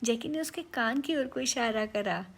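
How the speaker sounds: usual speaking voice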